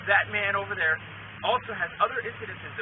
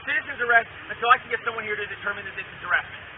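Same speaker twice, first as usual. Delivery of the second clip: calling out; 5 semitones higher, 5 dB louder than usual. Retold or imitated. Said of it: "All I did was call".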